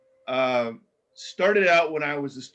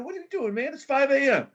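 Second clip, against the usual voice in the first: high-pitched, baffled call at dawn; startled question; 9 semitones higher than usual. Retold or imitated. Roommate finding his guest up before dawn, astonished. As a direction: ask it much higher, astonished. Woken at five a.m.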